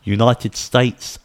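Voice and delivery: normal talking voice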